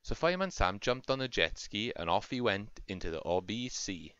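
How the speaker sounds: plain reading voice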